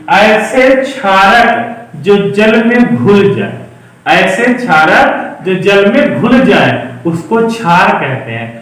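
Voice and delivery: slowly, word by word, as for notes; slow dictation. Shown as a demonstration. Teacher slowly dictating the definition to students. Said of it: "ध्यान से देखना".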